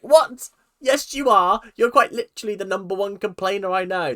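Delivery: loud, high exasperated protest; friends calling her out. Retold or imitated. Friends scoffing at the vlogger, loud exasperated protest. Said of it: "That's what my friends say to me".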